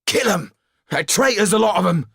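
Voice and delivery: high, emphatic angry outburst; heated burly man. A narrator's reading. Burly man arguing over the royal family, heated. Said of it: "said a burly man with a red face".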